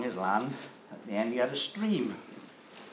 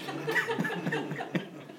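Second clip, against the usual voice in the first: laugh; 5 dB quieter than usual. Real, not acted. Speaker laughs at own punchline.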